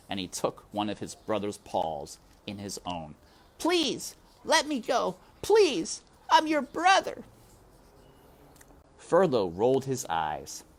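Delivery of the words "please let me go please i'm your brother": high, loud desperate pleading; high, urgent mouse begging. A narrator's reading. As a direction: plead for your life with your brother. Despero begs brother for freedom.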